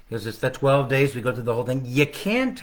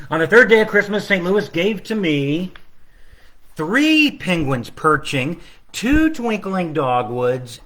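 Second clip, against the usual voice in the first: slow, lilting storybook reading, a bit higher and louder; reading to children; 4 semitones higher, 5 dB louder than usual. Acted. Author reading his Christmas book to children online. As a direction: build the list up brightly for the kids.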